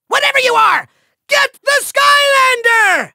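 high, shouted villain order; exasperated command. Acted. Villain sending a misnamed archer at the Skylander, exasperated shout. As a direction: yell the order in hammy impatience.

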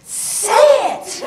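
high-pitched heckle from the balcony; imitated outburst at the stage; retold scream. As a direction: cry it out like an impatient audience member. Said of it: "screams out loud".

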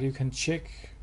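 ordinary talk

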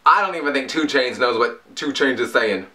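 usual speaking voice

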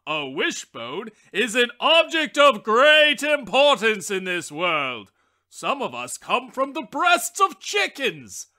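loud, pompous lecturing voice; boastful wishbone. A narrator's reading. Wishbone boasting to the shelf objects, loud and pompous. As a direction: puff up like a self-important know-it-all. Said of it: "with his head thrown back, he said".

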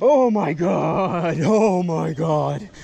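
overwhelmed by a huge catch; shrieking jackpot winner. Real, not acted. Sees massive catfish, gasps.

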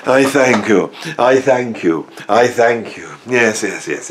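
lightly voiced showman acknowledging applause; mock bow to applause. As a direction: take a pretend bow. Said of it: "give me a round of applause now".

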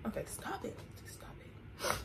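unvoiced whisper stop it. Real, not acted. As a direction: hush yourself in a whisper. Whispers to self.

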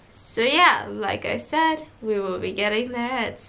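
almost lull them to sleep over the intercom; sleepy pilot voice. Retold or imitated. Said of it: "fall asleep again with your voice".

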